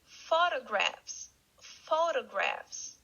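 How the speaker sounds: Photographs said slowly and clearly, stress on PHO; model pronunciation. Shown as a demonstration. Teacher models Photographs for students to repeat. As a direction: stretch out Photographs for the class. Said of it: "let's repeat".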